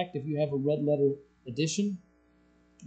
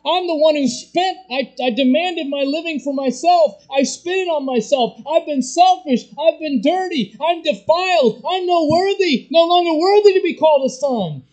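loud, high, broken-down confession of the lost son; repentant prodigal son; 12 semitones higher, 9 dB louder than usual. Pretend. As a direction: cry out your guilt as the prodigal. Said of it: "You say".

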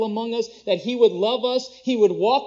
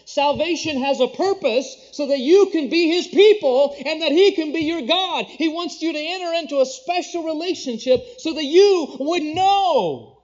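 fervent preaching; 7 semitones higher, 6 dB louder than usual. Acted. Preacher rising to full force.

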